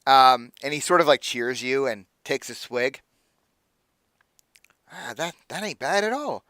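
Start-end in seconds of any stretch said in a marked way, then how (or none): 4.9-6.4 a touch higher, friendly, easygoing innkeeper type; an easy, impressed NPC, only lightly voiced; jovial merchant, pleased with the wine; lightly voiced Aldo.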